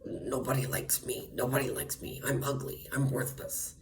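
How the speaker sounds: soft whispered whimpering mock; mock self-pity. Imitating the neighbor's self-pity in a whisper. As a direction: whisper it like a pitiful pick-me. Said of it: "And she said".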